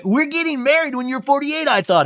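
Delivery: high, insistent imitation; mock protest. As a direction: pitch it way up as her retort. Acts out retort about marriage plan.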